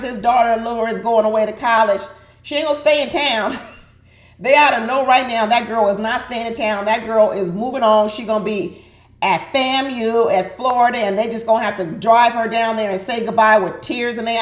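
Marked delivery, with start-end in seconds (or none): none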